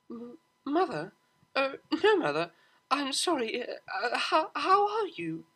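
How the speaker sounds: high, stammering nervous apology; flustered stammer. Acted. Daughter suddenly facing her mother, nervous stammer. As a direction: caught out, scrambling for words.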